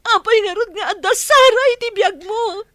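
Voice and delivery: loud, hurt accusation; angry shout. Acted. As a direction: demand an answer, loud. Mistress confronting cheating lover. (napungtot nga ikkis)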